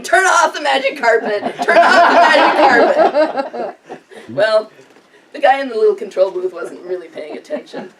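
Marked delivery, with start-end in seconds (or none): 0.0-3.8 retold shout, louder and higher, laughing through it; panicked yell. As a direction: yell it at the man in the control booth. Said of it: "He's yelling".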